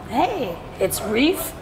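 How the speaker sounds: normal talking voice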